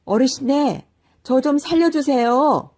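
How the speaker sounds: high, loud begging for help; the cow-man begging the old man to save him; desperate cry. A narrator's reading. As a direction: cry out in panic for rescue. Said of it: "더욱더 놀라 외쳤습니다".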